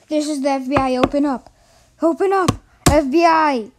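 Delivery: shouting loud and fast; FBI raid shout. Pretend. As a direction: bang on the door yelling.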